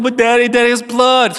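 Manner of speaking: high, imitated little-boy panic; freaked-out child. Young son to father about his scrape, imitated freak-out. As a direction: plead like a frightened kid. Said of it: "he freaked out".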